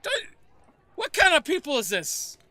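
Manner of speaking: high, loud shout to her husband; scandalised wife hollering; outraged wife. Acted. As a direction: yell in a shrieky wife voice, appalled. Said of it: "she's like".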